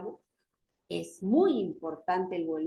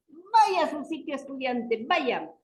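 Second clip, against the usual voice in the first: raised, higher-pitched teacher's command; acted scolding teacher; 5 semitones higher, 3 dB louder than usual. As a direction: imitate a teacher ordering pupils to sit. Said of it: "como a veces nos ponemos nosotros los maestros".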